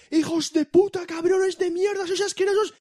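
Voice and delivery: ranting insults, pitched far up; mock columnist rant. Mocking columnists who insult then deny it, high mock rant. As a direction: ham up a raging newspaper columnist. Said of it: "Como esos columnistas, dice".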